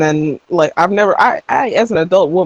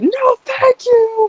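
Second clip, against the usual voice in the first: high, slow mock yelp of refusal; squeaky refusal; much slower than usual. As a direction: squeal the refusal as a panicked joke. Refusing to pee in dream.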